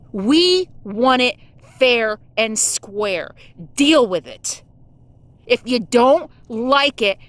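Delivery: furious, emphatic rant; real anger. Real, not acted. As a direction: spit each word out in fury. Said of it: "Yes, I'm angry".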